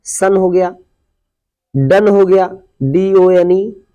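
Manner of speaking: ordinary talk, nothing marked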